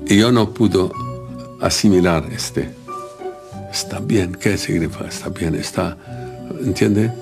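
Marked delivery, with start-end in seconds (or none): none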